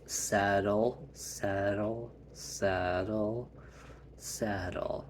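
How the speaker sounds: put-on calm a squirming child down; soothing hush. Calming Quinn, holding her arms.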